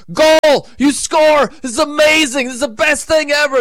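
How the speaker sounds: high, loud goal celebration; celebrating. Retold or imitated. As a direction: shout it like the stadium just erupted.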